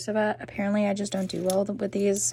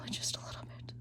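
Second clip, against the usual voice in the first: whispering; 16 dB quieter, faster than usual. Real, not acted. Careful with the new leaf.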